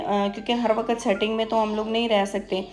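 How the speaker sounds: ordinary talk, nothing marked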